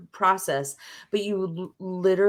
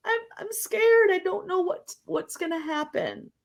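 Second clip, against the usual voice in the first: put-on high, small, fearful child voice that fades; a frightened child confessing her fear; scared little girl; 10 semitones higher than usual. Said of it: "that girl that's like".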